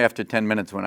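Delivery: normal talking voice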